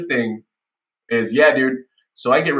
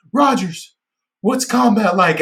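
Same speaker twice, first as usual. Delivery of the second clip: put-on drunk naive question; 9 semitones higher, 4 dB louder, slower than usual. Repeating recruiter's question.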